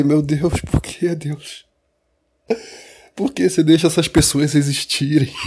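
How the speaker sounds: pleading mock prayer to God; hammed-up plea to heaven.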